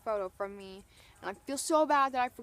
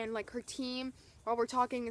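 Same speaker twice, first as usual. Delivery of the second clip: ordinary talk, nothing marked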